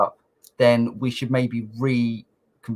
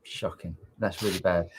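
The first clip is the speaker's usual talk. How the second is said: deep pitch shifted; 8 semitones lower, 12 dB quieter than usual. Acted. Deep voice effect on co-host.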